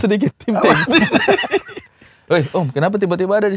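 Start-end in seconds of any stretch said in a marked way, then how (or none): 0.8-1.8 very high shrieking laugh; squealing laugh. Real, not acted. Laughs at the joke.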